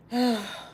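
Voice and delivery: real sigh while holding back grief; heavy sigh. Real, not acted. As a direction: sigh it out audibly. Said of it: "I'm sorry for all the sighing".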